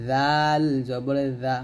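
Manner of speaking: very slow "জাল জবর জা", thin and light; slow letter demo. Shown as a demonstration. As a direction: stretch "জা" out thinly. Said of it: "আর এটা হবে". (খুব ধীরে পাতলা "জাল জবর জা")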